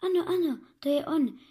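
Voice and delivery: high-pitched eager exclamation, falling back; excited cry. A narrator's reading. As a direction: cry out in recognition, pitched well up. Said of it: "vykřikl jsem". (vzrušené zvolání vysokým hlasem)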